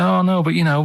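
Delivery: normal talking voice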